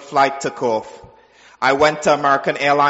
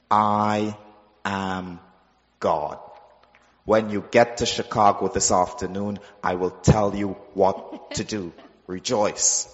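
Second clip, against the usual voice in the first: solemn voice of God; 6 semitones lower, 6 dB quieter than usual. Retold or imitated. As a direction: deliver I am God with weighty hushed calm. God declares himself.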